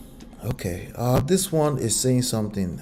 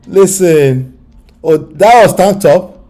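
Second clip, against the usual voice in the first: loud excited protest; 8 semitones higher, 12 dB louder, slower than usual. Real, not acted. Laughing protest.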